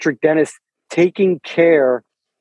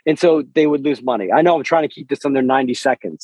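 talking faster and faster; speeding up; faster than usual. Real, not acted. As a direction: rush to fit it all in. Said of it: "So I'm talking faster and faster".

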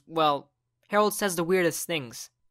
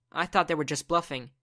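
ordinary delivery, nothing marked

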